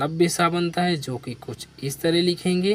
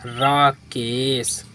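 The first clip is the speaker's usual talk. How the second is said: slow reading; 2 semitones lower, much slower than usual. Shown as a demonstration. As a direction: draw the name out slowly. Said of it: "क्या बन गया हमारा?".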